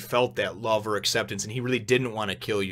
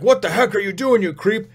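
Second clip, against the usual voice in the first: higher, louder outraged yell at a creep; freaked-out yell; 8 semitones higher, 6 dB louder than usual. Retold or imitated. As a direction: yell it like a kid who just snapped. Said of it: "he freaks".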